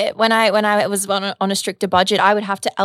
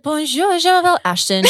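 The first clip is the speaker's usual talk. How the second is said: higher, playful mock French "Bonjour, je m'appelle Ashton"; imitating French; 8 semitones higher than usual. Mocking her co-host's French greeting. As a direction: ham up a schoolbook French greeting. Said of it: "I love when you're saying it".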